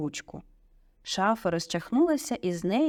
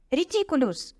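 high, moderate yell; 9 semitones higher than usual. A narrator's reading. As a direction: yell the spell with pitch way up. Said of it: "загорлав Дін".

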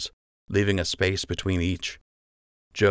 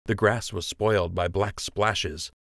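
ordinary delivery

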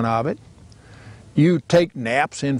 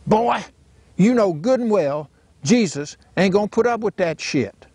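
daddy retorting; 7 semitones higher than usual. Retold or imitated. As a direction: fire back like a stubborn old daddy.

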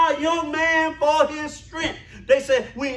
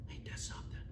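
breathy hushed aside; a hushed, knowing whisper to the church; 23 dB quieter, much faster than usual. Real, not acted. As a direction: lean in and whisper it.

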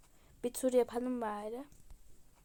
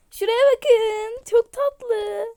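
squealing; 10 semitones higher, 14 dB louder, much slower than usual. Real, not acted. Squealing at cute animal.

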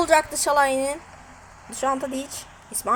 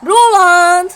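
screaming football commentator; 9 dB louder, much slower than usual. Real, not acted. Announcing goal scorer.

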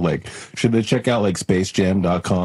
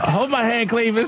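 high-pitched mock-nervous plea; pitched-up, hammed-up worry; cartoon villain pleading. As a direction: beg your partner while falling. Falling henchmen cling together.

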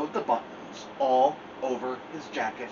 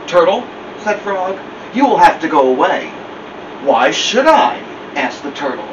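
much louder storytelling voice; loud reading; 12 dB louder than usual. A narrator's reading. Narrator reading the turtle scene much louder. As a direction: project it to the room.

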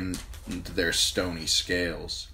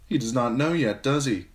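laugh-sob; 6 semitones higher, 5 dB louder than usual. A narrator's reading. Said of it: "her laugh was half a sob".

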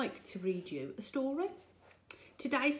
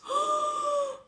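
high dramatic gasp; 4 dB louder than usual. Acted. Broom about to snap.

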